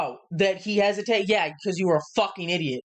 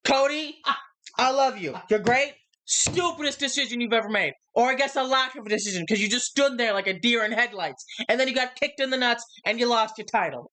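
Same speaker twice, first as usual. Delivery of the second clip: impassioned wrestling promo; 6 semitones higher than usual. Retold or imitated. Acting out Cody's heated promo.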